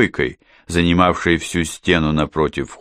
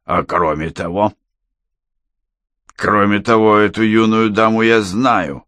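lightly voiced tipsy man; halting start, then a more insistent drinker's claim; drunken man; 3 semitones higher than usual. A narrator's reading. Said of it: "Пьёт он уже далеко не первый день". (слегка пьяный мужской голос)

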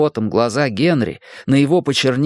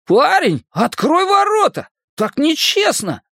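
shouting. Acted. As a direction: yell it through the gate. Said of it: "он крикнул".

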